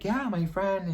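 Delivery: high-pitched, offhand protest; mock-innocent reply. Storyteller replays answering a date's questions about a friend, mock-innocent. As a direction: pitch it way up, as if it's obvious.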